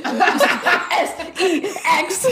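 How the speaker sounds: giggled, slow, high letters; naughty giggling spell-out; laughing spell-out. Retold or imitated.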